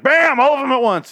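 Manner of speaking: abrupt loud bam; sudden exclaim. Real, not acted. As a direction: slam it out all at once. Complaining scene was rushed.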